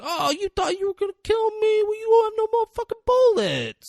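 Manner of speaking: high, loud hammed-up villain taunt; theatrical villain. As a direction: ham it up like a gloating movie bad guy. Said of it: "he's playing up the theatrics".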